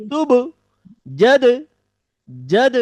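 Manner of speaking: তুব and জদ repeated as bouncing echoes of the stop; echoed syllables. Shown as a demonstration. Teacher repeating syllables to show qalqala echo. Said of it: "এই যে দ্বিতীয় দ্বিত্ব হচ্ছে এটি হচ্ছে কলকলা".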